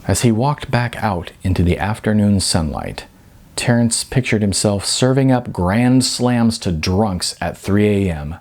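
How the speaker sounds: plain reading voice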